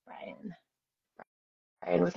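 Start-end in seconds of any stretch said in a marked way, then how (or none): 0.1-0.5 unvoiced whisper while typing; whispered to herself. Real, not acted. Spelling a viewer's name on screen, whispered. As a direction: whisper the name under your breath.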